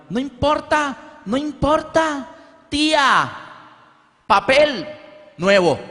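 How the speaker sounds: high, slow childish imitation; carefree child. Boy telling his mother the aunt will pay, high childish voice. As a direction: play the seven-year-old shrugging it off, high and slow.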